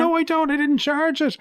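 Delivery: very high-pitched mock protest. Host retelling his protest to the tax office, high mock indignation. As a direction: squeak out an indignant denial, hammed up.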